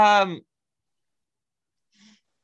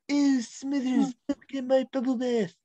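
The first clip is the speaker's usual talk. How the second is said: Mr. Burns impression; 7 semitones higher than usual. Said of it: "I'm going to do an impersonation".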